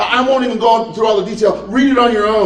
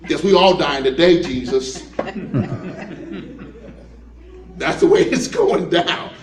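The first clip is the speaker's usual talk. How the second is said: sarcastic resignation; 2 semitones lower than usual. Acted. Disciple grumbling to the others that they're all dying, sarcastic. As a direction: grudgingly give in, with dry sarcasm. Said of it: "this was kind of sarcastic".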